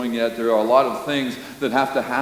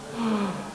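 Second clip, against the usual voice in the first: mock gasp; 8 semitones higher, 8 dB quieter than usual. Preacher reacts to the crowd.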